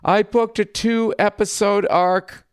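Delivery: high, slow mock announcement; boasting classmate. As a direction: imitate an actor proudly sharing big news. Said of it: "And then somebody else".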